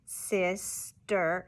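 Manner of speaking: "sister" slowly broken in two, sis and ster apart; students' syllable-by-syllable repeat of "sister"; chopped syllables. Shown as a demonstration. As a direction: chop "sister" into separate beats. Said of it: "repeat what I do".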